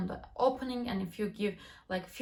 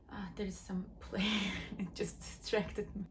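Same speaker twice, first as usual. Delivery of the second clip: quick whispered aside, then a laugh; 2 semitones lower, 7 dB quieter than usual. Real, not acted. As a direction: drop to a whisper as if someone might hear. Aside about a distracting noise.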